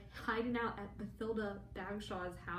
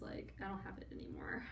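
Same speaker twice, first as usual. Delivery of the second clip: muttered fast under the breath; fast sheepish whisper; whispered aside; 12 semitones lower, 7 dB quieter, faster than usual. Retold or imitated. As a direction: whisper it quick, as if hoping no one hears. Scorpius shrugging off loss.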